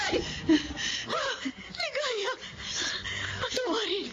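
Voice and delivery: high, desperate pleading cry. Acted. Guilty friend begging Ligaya's forgiveness, crying out high. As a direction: beg for mercy, crying out.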